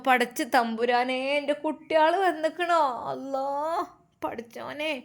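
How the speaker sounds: weep as you greet the grandchildren; tearful grandmother. A narrator's reading. Grandmother greeting the returning children, tearful.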